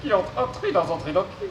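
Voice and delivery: robot voice with garbled consonants. Acted. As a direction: mangle the consonants like a glitchy robot. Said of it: "souffre d'une pathologie vocale".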